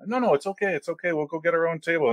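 high insistent invitation to sit. Retold or imitated. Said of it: "He wanted to sit at our table".

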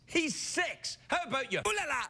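high, incredulous scolding; mock exasperation. Host answering parent about six-year-old's painting, mock exasperation. Scoffing at the letter writer's question.

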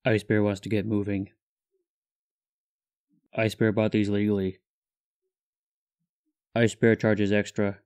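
flat, deep monotone in third person; Ice Bear deadpan. Acted. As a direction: speak like a stoic bear.

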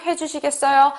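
ordinary talk

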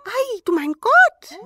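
high, loud, slow exclamation; amazed dwarf cry. A narrator's reading. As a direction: cry out in wonder. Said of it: "riefen sie".

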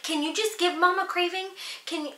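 lightly voiced dad pleading to the bump; talking to the belly. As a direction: coax the baby, a touch sing-song. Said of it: "like, talking to my belly".

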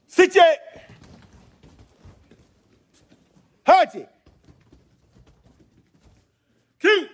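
sharp high count shouts; shouted count. Real, not acted. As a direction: bark out each count loud and high. Karate teacher shouting count.

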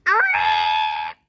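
loud, long squawking shriek; hen's shriek. A narrator's reading. Hen shrieks after swallowing the medicine, shrieking. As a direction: screech it like a startled hen. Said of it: "shrieked the hen".

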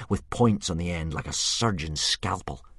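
plain reading voice

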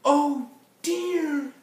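very high, loud character wail; dismayed Berlioz. Acted. Berlioz to the band, wagon stuck, high dismayed cry.

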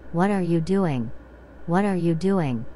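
ordinary delivery

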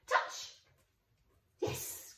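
ordinary talk, nothing marked

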